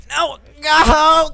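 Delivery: high, loud cry of protest; panicked shout. Real, not acted. Player losing control of the game, panicked shout. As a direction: shout it as everything goes wrong. Game going wrong, crying out.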